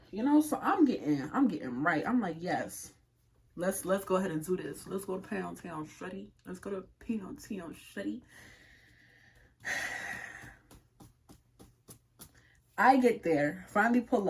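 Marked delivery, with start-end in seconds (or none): 2.4-8.2 soft, low-volume pep talk; hushed self-hype. Retold or imitated. Hyping herself up on the drive over, hushed. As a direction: say it under your breath to yourself.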